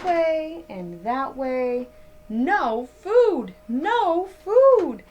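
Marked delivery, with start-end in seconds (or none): none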